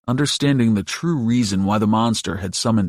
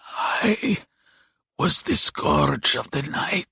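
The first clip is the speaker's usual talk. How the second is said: high, breathy monster rasp; slow, failing creature whisper; dying demon's whisper; 6 semitones higher, 4 dB quieter, slower than usual. A narrator's reading. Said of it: "Diavo whispered".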